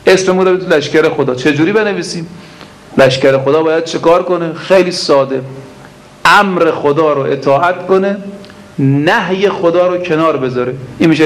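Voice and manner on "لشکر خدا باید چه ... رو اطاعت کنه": ordinary delivery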